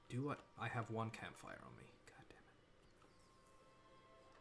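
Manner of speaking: usual speaking voice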